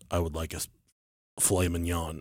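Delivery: deep alien voice. Retold or imitated. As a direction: sink into a low, quiet creature voice. Alien boyfriend orders again.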